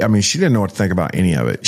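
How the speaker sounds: ordinary talk, nothing marked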